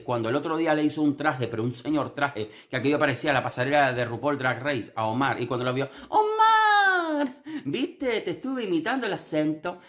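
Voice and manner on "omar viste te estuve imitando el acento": very high squealing mock imitation; mocking Lara's voice. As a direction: squeal it out, mocking her excitement. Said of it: "que imitas el acento canario fatal". (imitando a Lara, voz muy aguda y chillona)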